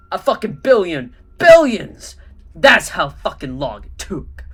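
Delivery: loud, furious rant; ranting Mysterio. Acted. Mysterio ranting about his ruined masterpiece. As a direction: vent your outrage.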